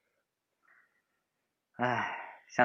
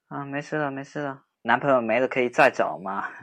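put-on playful bantering young man walking her home; teasing boyfriend-type friend. Teasing friend walking upset girl home, playful banter. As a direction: tease her, then soften and comfort.

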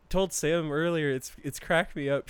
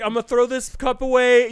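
higher and louder, mimicking his casual promise; mocking a roommate; 8 semitones higher, 8 dB louder than usual. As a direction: play the lazy roommate, a little hammed-up. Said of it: "and you were like".

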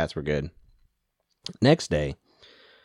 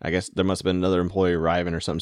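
ordinary talk

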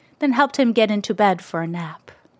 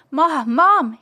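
higher, louder calls up the stairs; shouting; 6 semitones higher, 3 dB louder than usual. A narrator's reading. Tony shouts for his mom while racing upstairs, loud and high. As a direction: call out to mom urgently. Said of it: "he shouted".

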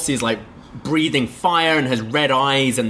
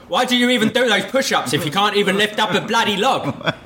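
much higher, faster, hammed-up posh Cockney; posher Cockney butler accent; 9 semitones higher, faster than usual. Actor retrying the line for his director, posher Cockney. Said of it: "remember he's a posh butler".

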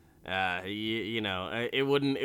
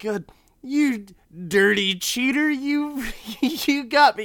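high, loud, half-laughing outrage; outraged high accusation; 12 semitones higher, 7 dB louder than usual. Retold or imitated. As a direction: sputter like a furious sore loser. Angry Reno voiced, laughing.

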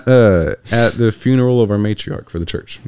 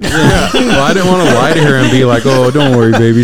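laughing; 7 semitones higher, 9 dB louder than usual. Real, not acted. Laughing while retelling reassurance.

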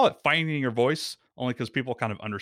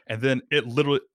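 normal talking voice

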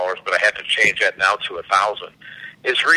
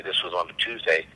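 usual speaking voice

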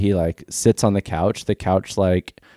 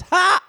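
a sudden, high, barking laugh; loud guffaw; 4 dB louder than usual. Retold or imitated. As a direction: blurt out one big "Ha!". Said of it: "guffaw that Julia Roberts is known for".

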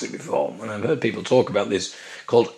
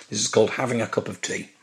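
normal talking voice

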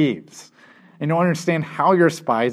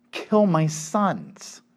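slow dragged out; 4 dB quieter, much slower than usual. Retold or imitated. Reuben's shocking offer.

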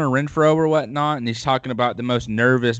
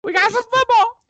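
way higher, louder hype-man voice; hyped-up weird voice; 4 dB louder than usual. Pretend. Host waking up his co-host, over-the-top hype voice. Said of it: "I'm done with that accent".

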